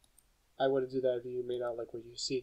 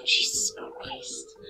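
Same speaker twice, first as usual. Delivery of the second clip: whisper; 3 dB louder, much slower than usual. Real, not acted. Shocked reaction to game scene.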